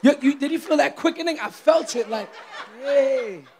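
loud, high burst of church excitement; excited preaching. Real, not acted. Preacher asking church if they felt it, excited shouting. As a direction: shout as if the Spirit just hit.

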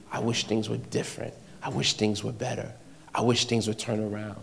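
low, very soft muttering to oneself; quiet murmuring. Acted. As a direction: grumble under your breath, as if only in your heart. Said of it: "Murmuring is a quiet mutter".

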